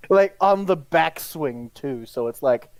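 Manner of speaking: usual speaking voice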